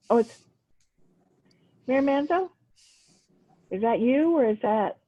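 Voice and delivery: ordinary talk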